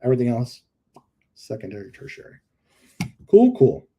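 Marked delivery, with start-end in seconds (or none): 3.3-3.9 excited. Real, not acted. Sudden loud exclamation.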